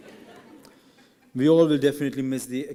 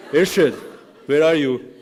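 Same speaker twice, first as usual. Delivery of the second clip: loud, raised call into the audience; 3 semitones higher, 8 dB louder than usual. Real, not acted. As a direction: call out for someone in a crowd. Calling out friend in audience.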